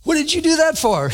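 high, sharp mock complaint at another driver; mock road rage; annoyed driver. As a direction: toss off a driver's irritated outburst. Cut off in traffic, annoyed quote.

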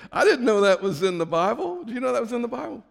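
preacher joking about a Bible cuss, amused; amused surprise. Real, not acted. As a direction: tease the crowd with mock shock. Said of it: "joking aside to congregation".

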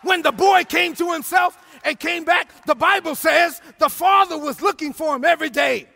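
loud, shouting sermon delivery, big swells on key lines; preaching at full force. Acted. Pastor exhorting the congregation and online viewers, shouting preacher. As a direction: preach it like a revival pastor calling his church home.

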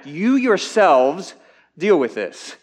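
"You yourselves" punched high, loud and slow; emphatic you. Retold or imitated. Jesus telling the disciples to act, heavy stress on "You". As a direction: lean hard on "You", then settle. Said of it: "is emphatic".

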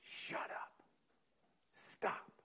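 sharp whispered orders; whispered command. Acted. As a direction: whisper it, hushing the storm. Jesus hushes the stormy sea.

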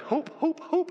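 high, quick repeated calls; high-pitched running cat's call; cat calling owner. Acted. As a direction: chirp the name over and over. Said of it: "Some of you be like".

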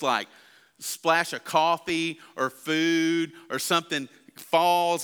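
ordinary delivery

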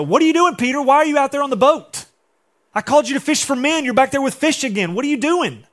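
loud, high, exasperated scolding; harsh scolding Jesus. Retold or imitated. Preacher mimicking an angry Jesus scolding Peter, loud and high. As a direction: berate him like a fed-up boss. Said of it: "That's not Jesus' tone".

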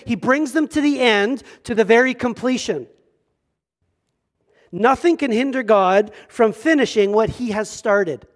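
fervent sermon delivery; preaching. Acted. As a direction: proclaim it to the congregation. Preacher reading and expounding scripture.

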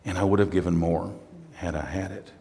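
soft, low, fond and devoted; a widower's tender vow; quiet devotion. Retold or imitated. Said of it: "He's saying".

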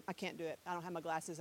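normal talking voice

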